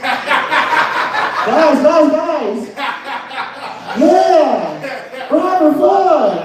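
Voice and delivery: high, excited calling through the window; excited kid calling out. Retold or imitated. Boy outside the window begging bullies, high excited call. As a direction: shout eagerly to the kids inside.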